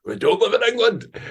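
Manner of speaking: put-on high, insistent Borders Scottish accent; exaggerated Borders Scottish. Borders locals disowning England, hammed-up Scottish accent. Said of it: "more and more Scottish sounding 'cause they're like".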